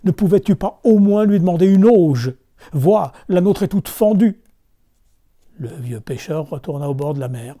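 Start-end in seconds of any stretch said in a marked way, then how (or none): 0.0-4.4 loud, high nagging; wife yelling at husband; angry scolding. Acted. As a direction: berate him for the cracked trough.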